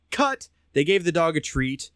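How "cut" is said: high-pitched mock call of "cut"; imitated film crew. Film crew ends the dog's scene, high mock call. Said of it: "They said".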